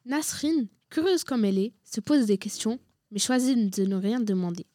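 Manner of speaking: ordinary talk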